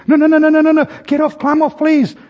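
high, frantic pleading mother; flustered mother begging her son. Retold or imitated. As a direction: beg the boy high and urgent.

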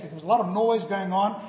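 usual speaking voice

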